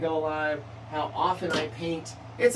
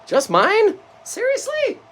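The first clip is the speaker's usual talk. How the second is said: excited; 11 dB louder than usual. Real, not acted. Excited reaction to a comment.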